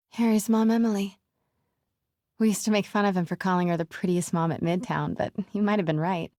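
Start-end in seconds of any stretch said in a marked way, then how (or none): none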